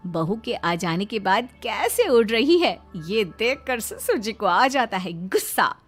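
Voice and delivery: plain reading voice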